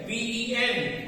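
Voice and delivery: letters slow and apart; spelled slowly. Shown as a demonstration. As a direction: spell each letter out for the class. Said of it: "spelling out the letters". (अक्षर-अक्षर धीरे बोलें)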